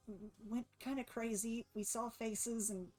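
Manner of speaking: hesitant, worried Olivia. Acted. Game master voices Olivia.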